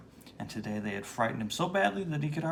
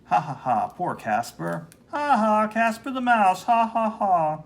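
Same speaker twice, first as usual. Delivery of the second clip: mocking bully; 8 semitones higher, 7 dB louder than usual.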